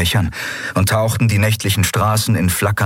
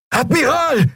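very high warning cry; alarmed shout. A narrator's reading. Officer warning admiral of a roadblock, alarmed shout. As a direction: shout a sudden warning to the driver.

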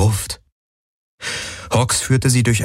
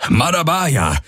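high-pitched announcing call; 8 semitones higher, slower than usual. A narrator's reading. Hawks announces the city to fleeing riders, calling out. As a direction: call out the city's name, pitch arching up. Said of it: "rief Hawks".

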